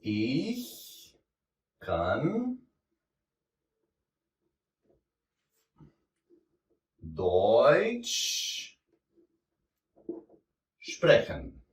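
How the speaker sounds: palavra por palavra, com longas pausas, bem devagar; ditado devagar. Shown as a demonstration. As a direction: dite cada palavra devagar enquanto escreve no quadro. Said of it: "Por exemplo". (pronuncia lenta e pausada, uma palavra de cada vez)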